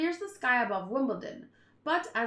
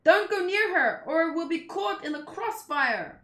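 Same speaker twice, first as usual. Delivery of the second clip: louder, urgent yelled commands; shouted orders; 5 semitones higher, 6 dB louder than usual. Acted. A soldier shouting orders at his men mid-attack. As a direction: bark orders at the troops.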